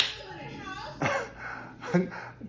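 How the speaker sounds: laughing. Real, not acted. Podcaster delighted by Rosie's read, helpless high laughter. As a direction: crack up at the comeback, high and drawn out.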